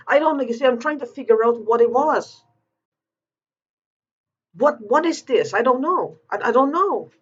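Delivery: pleading in frustration, voice pushed up high; getting upset. Real, not acted. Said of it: "I'm sorry I'm getting upset".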